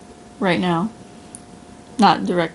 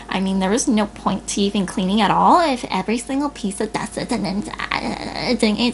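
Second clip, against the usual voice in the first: mocking whiny complainer; 2 semitones higher than usual.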